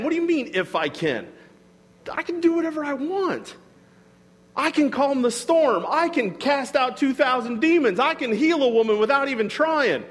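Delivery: high, incredulous boasts of power, dropping at the end; challenging. Retold or imitated. Jesus challenging doubt in his power, incredulous. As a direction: list feats in indignant disbelief. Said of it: "he's challenging my mentality".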